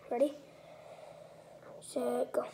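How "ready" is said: ordinary talk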